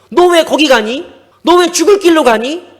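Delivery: calling out. Acted. God calling after a straying child, loud and pleading. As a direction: call after a child heading the wrong way.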